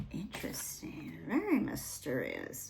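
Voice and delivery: hushed, intrigued whisper. Real, not acted. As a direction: breathe it like a secret. Reacting to card in hushed tone.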